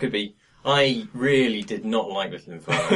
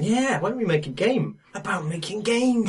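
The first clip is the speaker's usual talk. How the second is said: eager programmer voice; 10 semitones higher than usual. Retold or imitated. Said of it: "all the programmers are going".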